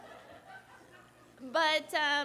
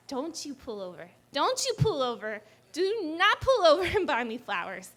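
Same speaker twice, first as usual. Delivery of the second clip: escalating protest; 2 semitones higher, 4 dB louder than usual. Retold or imitated. Girlfriend forbidding boyfriend to pull over, mock angry.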